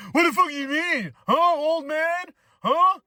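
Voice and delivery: high, put-on heckling of an old man; mock indignant challenge. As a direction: act out an offended youngster getting confrontational. Acting out offended young people.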